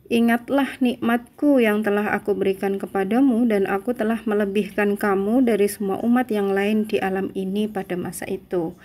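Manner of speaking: normal talking voice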